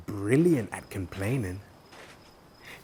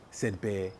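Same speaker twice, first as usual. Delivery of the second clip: ordinary delivery, nothing marked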